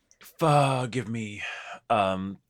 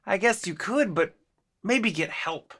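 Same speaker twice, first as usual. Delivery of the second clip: hesitant; 8 semitones higher, slower than usual. Retold or imitated. Voices own hesitant reaction.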